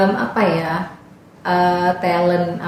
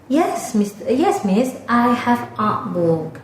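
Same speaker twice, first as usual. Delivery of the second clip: higher-pitched Thai pupils answering their teacher; Thai students' accent; 5 semitones higher, much slower than usual. Retold or imitated. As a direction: imitate Thai learners, slow drawn-out "a book". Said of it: "Mereka bilang". (meniru logat murid Thailand)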